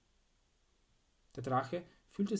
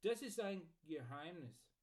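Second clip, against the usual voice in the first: teasing, higher-pitched secret-keeper; playfully mysterious, raised pitch; grinning; 4 semitones higher, 4 dB quieter than usual. A narrator's reading. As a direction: keep a secret with a grin. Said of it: "grinste Archibald".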